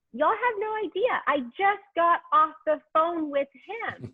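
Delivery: jumps up high, then slows the words down; excited reveal. Retold or imitated. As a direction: tell the crowd the big news, half laughing at it. Excited retelling to live viewers.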